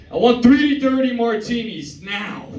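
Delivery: high, slow, demanding finance bro; rude rich guy. Retold or imitated. Private equity guy ordering from the host, slow and entitled. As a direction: order it slowly like he owns the place. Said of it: "he's like".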